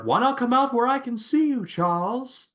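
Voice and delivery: high-pitched hammed-up movie villain quote; mock Magneto. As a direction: call it out high and mocking. Mimicking Magneto's line to Charles.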